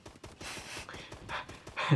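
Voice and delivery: breathy, quiet laughing; real chuckle to himself. Real, not acted. Gamer caught out by his own game clip, laughing. Laughs before joking "Psych.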